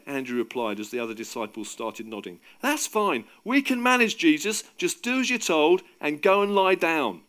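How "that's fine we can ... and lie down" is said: loud, high, bossy reassurance; raised, projected and insistent; calling out. A narrator's reading. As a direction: order a friend off to rest. Andrew calls out telling Jesus off.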